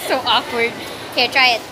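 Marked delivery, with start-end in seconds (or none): none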